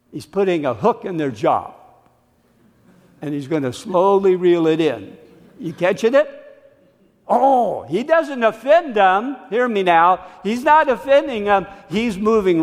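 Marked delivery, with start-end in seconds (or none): none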